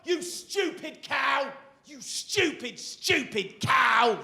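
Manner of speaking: high, drawn-out furious yelling; angry shouting man. Retold or imitated. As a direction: scream abuse at the barista, stretched slow. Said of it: "he just starts shouting".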